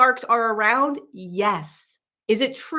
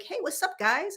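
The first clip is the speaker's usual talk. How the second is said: high, soft, casual greeting from a sea creature; lightly cartoonish manatee, higher and quieter; friendly manatee voice; 7 semitones higher, 5 dB quieter than usual. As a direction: voice the curious manatee saying hi. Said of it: "It literally was like".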